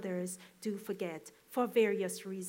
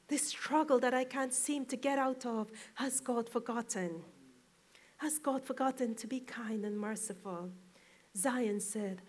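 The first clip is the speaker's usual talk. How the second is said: usual speaking voice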